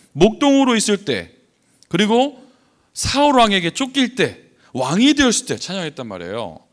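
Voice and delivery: loud, emphatic sermon delivery; forceful preaching. Real, not acted. Preacher urges the congregation on praising God, in forceful preaching style. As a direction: drive the key phrases up high and hard.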